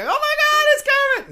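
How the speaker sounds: hammed-up starstruck squeal; mock thrilled squeal. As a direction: gush like a starstruck fan seeing the Muppets arrive. Staff imagined thrilled at Muppets.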